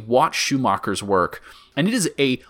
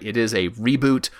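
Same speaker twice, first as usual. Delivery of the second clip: ordinary delivery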